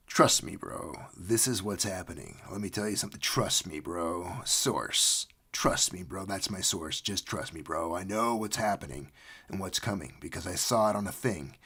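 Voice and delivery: low, hushed insider voice; low conspiratorial mock confidence; confiding know-it-all. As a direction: play a cocksure tipster, low and soft. Voices a know-it-all rumour source.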